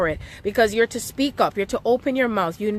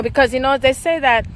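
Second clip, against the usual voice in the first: ordinary delivery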